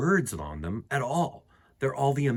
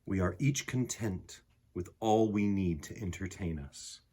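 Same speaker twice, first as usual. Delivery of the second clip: calm, softer read-aloud voice with unhurried pauses; gentle poem reading; 4 semitones lower, 4 dB quieter than usual. A narrator's reading. Reading the picture book poem to listening children, calm and soft. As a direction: read it like a quiet bedtime poem.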